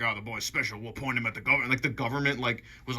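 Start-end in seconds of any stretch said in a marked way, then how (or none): 0.0-1.7 Southern dad. Retold or imitated. Speaker imagining the movie dad talking about his boy, light Southern accent. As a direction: imitate a Southern father, understated. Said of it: "he's Southern in my head".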